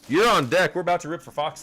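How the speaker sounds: loud, high hype announcement; hype call-out. Real, not acted. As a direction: call the next name out big. Calling up next viewer on stream.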